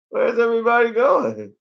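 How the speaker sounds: high, bewildered comic voice; confused dinosaur. Acted. Voicing the dinosaur as everyone leaves the island, comic bewilderment. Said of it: "He's just confused".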